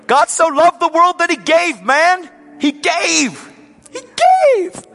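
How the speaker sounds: high, loud preaching cry; building to a soaring high cry on "He gave"; preaching at full force. Real, not acted. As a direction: lift it up and shout the gospel. Preaching climax.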